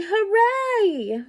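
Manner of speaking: high, loud crowd cheer; cheering hooray. A narrator's reading. Villagers cheering the whale away, loud. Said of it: "villagers shouting".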